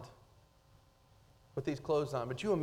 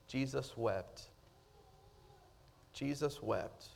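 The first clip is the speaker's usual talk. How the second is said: hushed solemn; 3 semitones lower, 9 dB quieter, slower than usual. Acted. Solemn reading of key verse.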